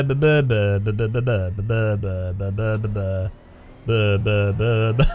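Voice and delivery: low, droning mock burr of dull English; low monotone burring; droning burr imitation. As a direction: drone it like a bored engine. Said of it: "it's very much like a burr".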